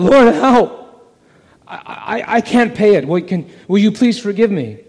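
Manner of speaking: loud, high desperate plea for mercy; crying out for help. Acted. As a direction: beg the Lord at the top of your voice. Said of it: "he cries out".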